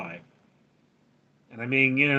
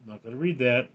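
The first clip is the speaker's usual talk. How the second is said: normal talking voice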